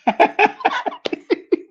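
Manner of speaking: high-pitched burst of real laughter; helpless high giggling fit; laughing. Real, not acted. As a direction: crack up in a long, high laugh. Cracks up at own marriage reveal.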